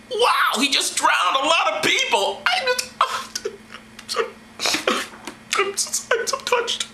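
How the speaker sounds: high, fluttery fake wonder; mock awe. Comic playing awed Israelites, high breathless fake wonder. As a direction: gush in hammed-up awe, pitched high.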